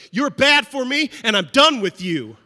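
loud, high declaration of being done; dramatic breakup. Pretend. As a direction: shout them out of your life. Acted cutting someone off.

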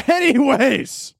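laughing anyways. Real, not acted. Laughing off the bit.

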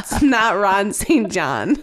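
slow, laughing through the words. Real, not acted. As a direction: giggle out the denial. Joke about guessed baby name.

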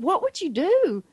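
high imitated boy's question; animated child. Son tests his mother's love, imitated animated plea. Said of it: "he was so animated".

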